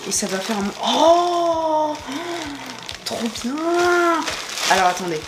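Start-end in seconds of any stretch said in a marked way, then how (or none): 0.8-4.2 high delighted cry; thrilled squeal. Real, not acted. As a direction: squeal with joy. Finds lit-up piece in box. (cri de joie aigu)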